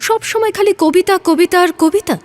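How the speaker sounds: exasperated. Acted. Fed up with his poetry.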